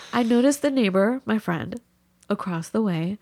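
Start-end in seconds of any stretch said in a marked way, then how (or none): none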